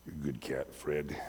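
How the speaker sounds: gently praising the cat in the dark; tender to the cat. Retold or imitated.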